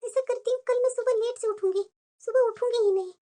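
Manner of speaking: hushed, plotting to herself; quiet scheming aside. Acted. New bride plotting to herself, hushed scheming. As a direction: murmur the plan so the family can't hear.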